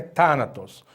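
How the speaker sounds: "thanatos" with THA emphasized; stress on first syllable. Shown as a demonstration. Teacher asking how the stress is known, "thanatos" stressed on THA. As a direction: put the weight on THA in "thanatos". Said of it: "Por causa do acento agudo".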